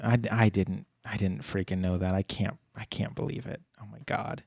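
dropping to a near whisper in disbelief; stunned hush. Real, not acted. Cohost stunned he missed a fact, hushed disbelief. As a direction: let it fall away, floored and breathless. Shocked after laughing.

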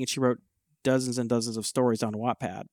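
usual speaking voice

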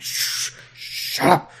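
urgent whispered shush; hushing whisper. Retold or imitated. The doctor silences Leela, in an urgent whisper. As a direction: hush her before she gives it away. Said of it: "And the doctor's like".

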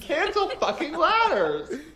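high, slow laughing protest; laughing mock indignation at ladders; laughing mock outrage. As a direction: cry it out through a laugh.

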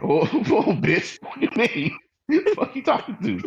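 hammed-up offended yell; offended outburst. Acted offended partner quote.

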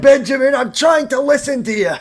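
high, loud telling-off; annoyed mock yell. Recapper as Leo telling noisy Ben off, high mock yelling. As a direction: yell back to shut someone up.

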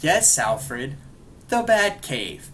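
sarcastic. Retold or imitated. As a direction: spell out the obvious to a confused butler. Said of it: "you never see Batman sarcastic".

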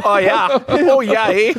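rounded Wisconsin "Oh, yeah, eh?"; hammed-up Upper Midwest accent; mock Wisconsin joking with friends.

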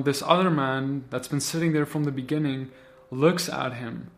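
ordinary delivery

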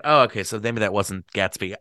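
plain reading voice

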